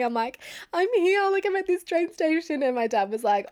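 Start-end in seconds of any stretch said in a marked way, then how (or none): 0.7-2.6 high, louder mock-crying voice; retold tearful wail. Upset daughter phoning dad from the station, tearful wail. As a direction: wail it like you've burst into tears. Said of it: "start crying".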